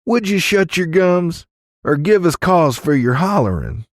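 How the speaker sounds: high, scolding saloon matron; snapping woman. A narrator's reading. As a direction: scold the hollerer sharply. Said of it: "Marjorie snapped".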